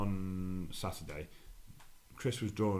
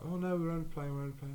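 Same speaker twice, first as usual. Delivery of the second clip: whiny protest; 8 semitones higher than usual. Retold or imitated. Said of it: "they're both like".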